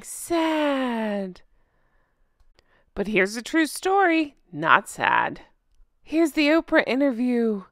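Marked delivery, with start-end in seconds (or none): 0.0-1.4 soft, quiet, droopy mock-sad sigh of a word. Mocking her staged sadness. 4.5-5.4 deadpan. Pretend. Punchline after true story.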